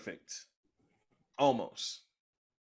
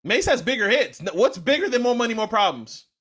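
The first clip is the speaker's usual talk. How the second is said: loud, high, fired-up arguing; heated insistence; 10 semitones higher, 8 dB louder than usual. Real, not acted. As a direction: push back hard, all worked up. Heated rap debate, insisting.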